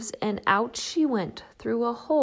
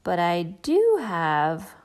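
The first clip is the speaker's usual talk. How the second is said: triumphant; 3 semitones lower, 4 dB louder, slower than usual. Acted. Triumphant realisation.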